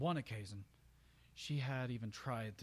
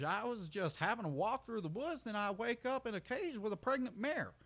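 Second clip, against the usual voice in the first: ramble, puzzled and friendly; folksy country stallion; 9 semitones higher, 4 dB louder than usual. Acted. Brayburn talks to Roseluck.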